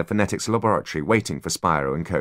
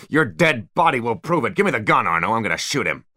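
raised, unhinged villain voice; furious threat; 5 semitones higher, 3 dB louder than usual. A narrator's reading. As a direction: threaten him, losing control. Spyro's threats at gunpoint.

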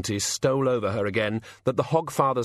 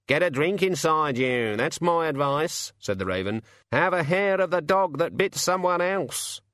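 a cheeky raven sneering from above; sardonic raven; 7 semitones higher than usual. A narrator's reading. Raven gives advice.

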